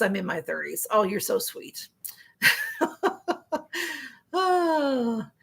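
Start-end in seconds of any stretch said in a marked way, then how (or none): none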